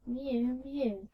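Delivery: high, loud cat's cry; crying mew. A narrator's reading. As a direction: mew out loud like a hungry cat. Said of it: "cried Simpkin".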